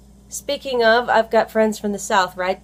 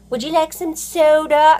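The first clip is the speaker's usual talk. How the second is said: higher, louder mock voice of a host offering soda; a hammed-up higher voice offering a drink; mock polite offer; 8 semitones higher, 5 dB louder than usual. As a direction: play the polite outsider asking. Mimics someone offering soda.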